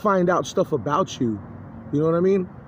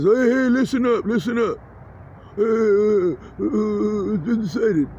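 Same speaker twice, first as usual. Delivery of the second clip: imitated announcing voice; 4 semitones higher than usual. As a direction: mimic someone demanding attention, pitched up. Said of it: "whoever you are that says".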